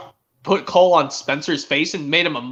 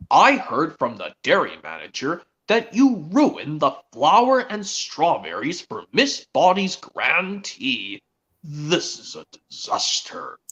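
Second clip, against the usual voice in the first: slow, drawn-out mock scolding; Sir Topham Hatt; slower than usual. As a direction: scold deliberately, stretching each word. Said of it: "the way Sir Topham Hatt scolds Thomas".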